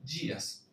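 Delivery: días with a soft dj sound; días en portugués; Portuguese dias. Shown as a demonstration. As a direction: say días as djias. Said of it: "va a sonar directamente días".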